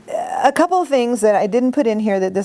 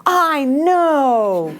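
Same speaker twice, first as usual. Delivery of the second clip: matching intensity; 7 semitones higher, 3 dB louder than usual. Retold or imitated. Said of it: "And I'm like".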